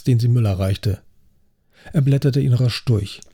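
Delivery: ordinary delivery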